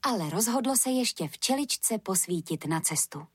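usual speaking voice

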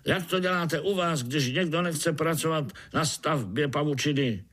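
high, bossy ant voice; high-pitched inquisitive foreman; overseer questioning. Acted.

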